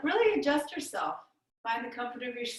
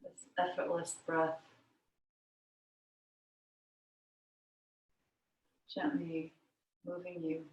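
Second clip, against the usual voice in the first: guided meditation; 5 semitones lower, 6 dB quieter than usual. Real, not acted. Yoga teacher guiding students into the breath, meditative. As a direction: lower and quieter, easing them gently into stillness.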